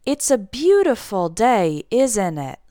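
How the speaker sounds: slow demonstration, ISN'T IT going down; deliberate statement with downward ISN'T IT; falling question tag. Shown as a demonstration. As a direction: draw out BEAUTIFUL DAY, then let ISN'T IT fall. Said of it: "it goes down".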